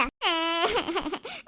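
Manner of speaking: put-on childish wail, rising; crying. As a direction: wail like an upset toddler. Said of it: "നീ എന്തിനാ കരയുന്നേ". (കുഞ്ഞിന്റെ കരച്ചിൽ)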